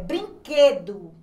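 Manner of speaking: slow, loud "brinquedo", QU as a plain k; careful pronunciation demo. Shown as a demonstration. Teacher showing pupils the QU sound, slow emphatic "brinquedo". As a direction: stretch "brinquedo" out, leaving the U silent. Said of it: "A gente pronuncia o Q".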